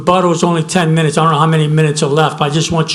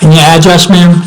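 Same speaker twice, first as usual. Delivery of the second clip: louder; 11 dB louder than usual. Real, not acted. Asking caller on bad line.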